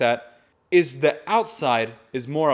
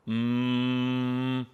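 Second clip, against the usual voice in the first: long steady resonant hum; sustained front-placed "mm"; humming; 3 dB quieter than usual. Shown as a demonstration. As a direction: hum on one held note. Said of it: "is humming".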